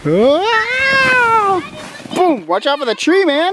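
excited whoops flying downhill; sledding downhill. Real, not acted. Sledding down a steep hill.

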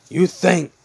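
loud, high, acted fury; enraged character yell. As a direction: build to a shout at a hiding enemy. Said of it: "Hazel's like".

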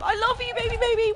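cooing. Real, not acted. Cooing at a pet.

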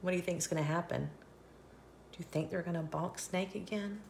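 ordinary delivery, nothing marked

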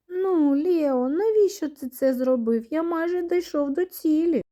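high-pitched, upset reproach; reproachful complaint. A narrator's reading. Персонаж докоряє другові за витівку. (докірливо, високим голосом)

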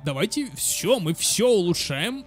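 ordinary talk

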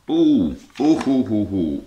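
delighted, high, loud ooh ooh ooh; rising thrilled oohing; excited oohs. Real, not acted. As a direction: coo over the find with real glee. Excited unboxing find.

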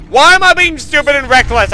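loud, high self-reproach; scolding oneself. Pretend. Player scolding themself as if another person, exasperated. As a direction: tell yourself off out loud. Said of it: "talking to myself like I'm another person".

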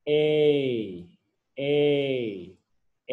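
"A" held long as "ay". Shown as a demonstration. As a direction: repeat a drawn-out "A" for pupils to copy. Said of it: "ยาวด้วย". (ออกเสียง "A" ยาวให้พูดตาม)

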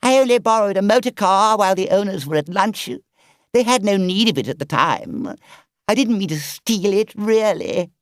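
sheepish Toad. Acted. Toad excusing the car theft to the driver, sheepish. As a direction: wriggle out of the blame.

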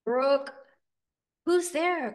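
high, loud toad croak; acted toad calling out. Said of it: "croaked a toad".

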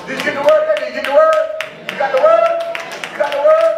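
loud, soaring preacher's shout; rousing call-out. Real, not acted. Preacher checking the congregation got the word, rousing shout. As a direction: holler it to the crowd, voice climbing high.

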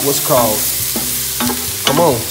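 normal talking voice

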